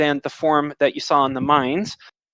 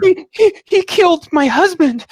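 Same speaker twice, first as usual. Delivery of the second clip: stammering, high-pitched wail; panicked, tearful woman; 13 semitones higher, 5 dB louder than usual. Acted. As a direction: scream it in horror, loud and very high. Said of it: "she has tears down".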